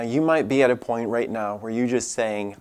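normal talking voice

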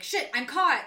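retold outburst of dismay; mock panic. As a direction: blurt it like you've been found out, lightly hammed up. Acting out her immediate alarm.

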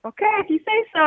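high, sarcastic "if you say so"; condescending. Retold or imitated. Fisherman brushing off his friend's advice, condescending and high. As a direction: humour him with a sneer. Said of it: "super condescending like that too".

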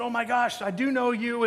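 high-pitched delighted realization, lightly acted; retold surprised recognition. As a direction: imitate a friend suddenly recognizing someone. Retold surprised recognition of old friend.

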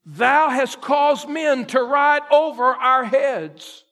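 slow, loud shouted scripture; preaching at full force. Acted. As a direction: thunder the verses out slowly.

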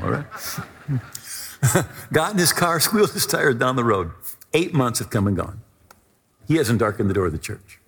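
normal talking voice

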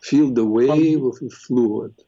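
slow, low guided meditation voice. Acted. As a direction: ease them into calm breathing. Leads a guided eye meditation.

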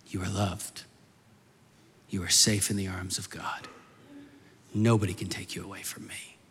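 low, soft, voiced declaration; solemn reassurance. God's words echo the father's whisper.

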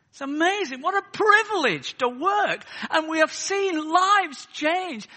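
loud, high, rousing pulpit cry; fervent preacher in full flow; impassioned preaching. Real, not acted. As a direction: preach it at full force, building to a declaration.

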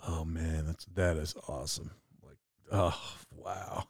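low, hushed awe; a lightly hushed, awed buddy; awed listener. Retold or imitated. Second guy in awe of the deer story, hushed. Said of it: "I'm gonna do both parts".

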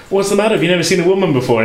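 hammed-up indignant woman, pitched well up; a lightly acted angry woman. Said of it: "angry kind of woman".

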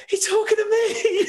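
excited disbelief. Retold or imitated. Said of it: "and I was like".

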